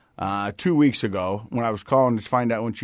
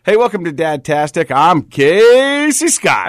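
loud, slow, high radio host intro; bad morning radio; 6 semitones higher, 8 dB louder than usual. Shown as a demonstration. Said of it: "sounds like, like, really bad morning radio".

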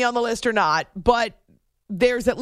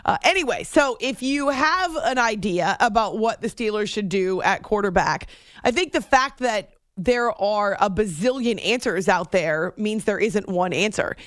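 ordinary delivery

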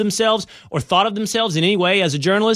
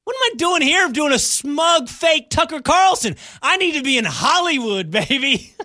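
hammed-up bragging; 11 semitones higher than usual. Host claims he belongs in Hollywood, over-the-top mock bragging. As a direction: crow it like a self-declared star.

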